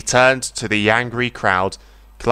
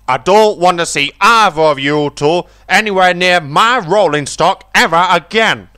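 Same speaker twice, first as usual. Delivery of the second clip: louder, higher stern command; angry order; 7 semitones higher, 6 dB louder than usual. A narrator's reading. Fat Controller orders Bruiser off the railway, angry. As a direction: throw him off the railway.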